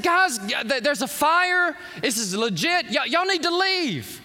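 high, loud, hurried; urgent warning. Retold or imitated. Preacher telling the gym crowd to leave, loud and urgent. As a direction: warn the room to get out now.

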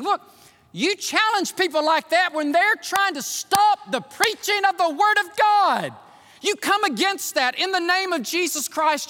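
high, forceful preacher's exhortation; full-force preaching. Acted. Preacher urging church to challenge opponents, fervent. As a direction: drive it out at a high pitch.

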